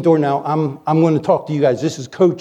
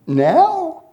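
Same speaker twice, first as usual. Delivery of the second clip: much higher startled "Now?"; mock surprise; 11 semitones higher than usual. Preacher reacting to the old man, startled now. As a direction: pop it up in disbelief.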